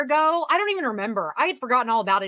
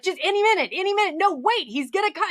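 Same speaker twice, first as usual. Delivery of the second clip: higher, impatient waiting; eager Harry; 8 semitones higher than usual. Retold or imitated. Imitating Harry waiting for his dad, high and eager. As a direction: bounce on every any minute. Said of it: "And he's like".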